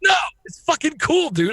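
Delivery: high, excited outburst. Real, not acted. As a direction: burst out with real excitement. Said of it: "I get excited".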